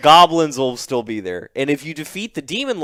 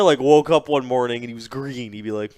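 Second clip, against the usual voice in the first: ordinary talk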